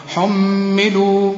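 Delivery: حملوا drawn out very slowly, final waw kept; slow recitation demo. Shown as a demonstration. Teacher reciting a Quran word slowly for a student. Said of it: "تثبت الواو إذا وقفت".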